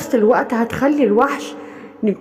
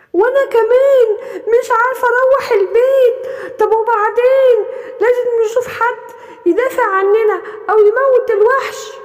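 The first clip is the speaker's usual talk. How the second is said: scared animal; 8 semitones higher than usual. Acted. Frightened animal near tears.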